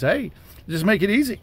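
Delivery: ordinary talk, nothing marked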